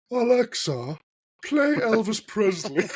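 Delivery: high, slow, put-on posh voice. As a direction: talk to the speaker like royalty. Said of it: "for some reason he talks to it in a posh voice".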